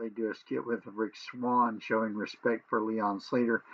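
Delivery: ordinary talk, nothing marked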